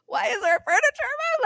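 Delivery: high-pitched panicked question, played up; mock freak-out. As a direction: voice shoots way up in retold alarm. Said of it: "I'm, like, freaking out".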